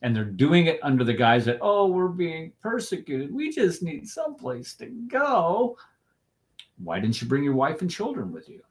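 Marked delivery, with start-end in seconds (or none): 1.6-5.8 high, slow, whiny mock plea; mocking whine. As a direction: sneer it out as a pitiful excuse. Mocking the migrants' claimed excuse.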